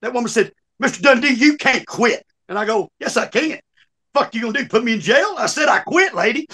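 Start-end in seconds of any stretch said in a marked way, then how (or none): 0.8-2.2 high, raised mock scolding; insistent jail lady. Jail lady to inmate who quit, insistent. 3.0-6.5 higher-pitched cocky comeback, retold; defiant inmate. Inmate quitting to the jail lady, defiant.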